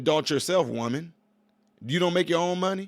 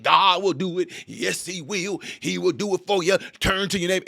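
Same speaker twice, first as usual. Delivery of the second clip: a hint of a church preacher, higher and louder; mock-preach the promise to the crowd; imitating a preacher; 4 semitones higher, 4 dB louder than usual. Mocking an empty church sermon to his viewers: lightly voiced preacher. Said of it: "the preacher be up there preaching".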